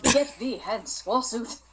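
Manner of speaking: loud mock Shakespearean challenge; stagey fake archaic declaiming. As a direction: ham up old-timey knightly speech. Mock medieval banter in game.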